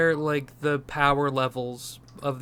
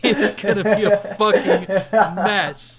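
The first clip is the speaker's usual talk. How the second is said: loud, high, helpless laughing delivery; 5 semitones higher, 10 dB louder than usual. Real, not acted. Host dreading four more movies, laughing loudly. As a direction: crack up loudly on the words. Laughing about the growing timeline.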